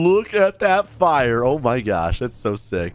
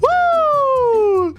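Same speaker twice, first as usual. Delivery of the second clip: very high, long whoop; triumphant whoop; 3 dB louder than usual. Real, not acted. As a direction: let out a squealing cheer after a stunt. Whooping after the stunt.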